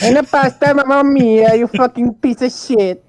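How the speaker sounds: high, loud mock Italian rant; fake Italian accent. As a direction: shout like a cartoon Italian.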